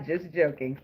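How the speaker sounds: real laugh as you take it back; giggly retraction. Real, not acted. Laughing off own joke.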